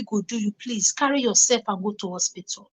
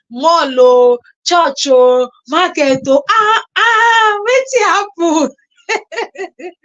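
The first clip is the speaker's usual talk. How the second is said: excited; 5 semitones higher, 6 dB louder, much slower than usual. Real, not acted. Excited listing, laughing.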